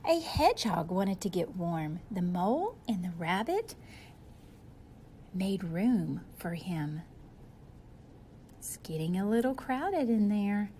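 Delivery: ordinary delivery, nothing marked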